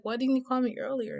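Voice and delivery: high-pitched acted complaint; mild reproach. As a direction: whine a little at your boyfriend. Complains to boyfriend, retold.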